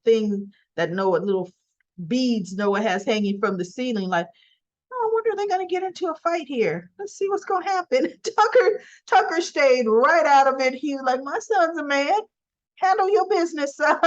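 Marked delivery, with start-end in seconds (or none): 4.9-8.2 high, fast, gleefully curious; amused onlooker. Retold or imitated. Bystander hoping the fight breaks out, high and gleeful. 11.2-14.0 high-pitched, goading; proud dad. Retold or imitated. Father urging his son to handle it himself, goading. Tucker voiced, cheering son on.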